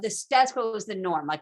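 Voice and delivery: ordinary talk, nothing marked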